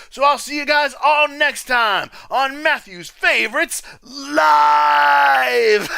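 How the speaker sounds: high, rising to a loud, long shout on LIVE; big show sign-off. Real, not acted. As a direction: call out the show title like an announcer. Hyped livestream sign-off.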